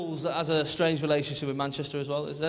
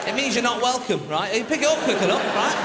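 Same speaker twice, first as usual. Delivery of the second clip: much louder, higher comedic rant; 8 semitones higher, 6 dB louder than usual. Acted. Comedian ranting to the crowd about Liverpool, loud. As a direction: shout it out like a ranting comic.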